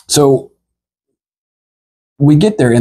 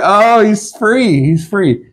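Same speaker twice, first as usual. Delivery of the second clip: slow wistful resignation; 6 semitones higher, much slower than usual. Retold or imitated. Watching the bird fly off.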